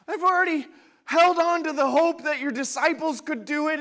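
loud, high, pleading cry; desperate father's plea. Acted. Father begging Jesus to heal his son, loud and high. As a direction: cry out to Jesus with nothing left. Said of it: "that's the father in this situation".

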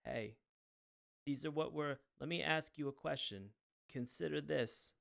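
low, quiet, flat monotone delivery; a lightly done awkward presenter; flat Todd impression. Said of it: "just imagine somebody".

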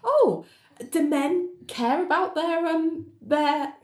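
high, delighted mock teasing; lightly voiced amused woman; amused Ali. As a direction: tease him, barely holding back a laugh. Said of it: "And Ali's like".

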